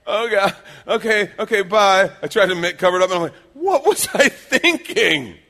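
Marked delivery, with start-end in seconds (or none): 0.1-2.1 loud, high-pitched flustered retreat; mock panic. Boy flees his father's look. 3.5-5.4 slow, loud, high-pitched self-reproach; mock horrified wail. Panicked self-reproach after the look.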